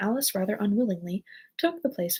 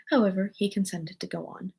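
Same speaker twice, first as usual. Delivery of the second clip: plain reading voice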